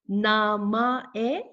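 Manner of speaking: very slow "Namae", each syllable stretched; broken down. Shown as a demonstration. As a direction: draw out "Namae" as na-ma-e for learners. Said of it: "Let me break this down for you".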